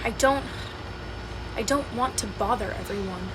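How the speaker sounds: quiet admission. Acted. Hannah confessing to her friend, quiet and low. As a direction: let it fall almost to nothing. Admitting she hides her needs.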